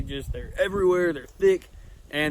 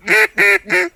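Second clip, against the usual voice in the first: loud high duck call blast; duck call honks; 13 dB louder than usual. Shown as a demonstration. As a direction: blow a sharp hen quack. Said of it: "do that".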